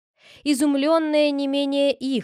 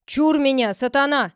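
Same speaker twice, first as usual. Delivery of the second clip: louder, higher warding-off cry; warding off evil; 4 semitones higher, 6 dB louder than usual. Acted. The clerk shouts through the keyhole at the devil. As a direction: ward off the devil through the door. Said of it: "продолжал писарь".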